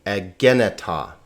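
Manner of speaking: "egeneta" with the weight back on "gen"; stress on second syllable. Shown as a demonstration.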